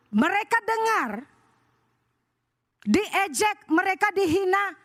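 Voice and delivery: high-pitched, impassioned pulpit voice; fervent preaching. Acted. Preacher urges church to hand insults to God, fervent preaching. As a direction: preach and pray it high, drawing out the calls to God.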